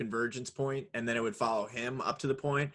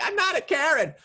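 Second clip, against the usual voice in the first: much higher, louder indignant protest; mock defensive squeal; 6 dB louder than usual. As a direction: squeal the denial in outrage. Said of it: "You're like".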